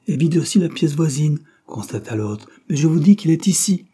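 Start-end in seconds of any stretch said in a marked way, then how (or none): 0.1-1.4 higher and louder, calling out the finding; raised report. Acted. Agent reporting empty room. 2.7-3.9 higher and louder, refusing to back down; stubborn insistence. Acted. Agent insists against doubt.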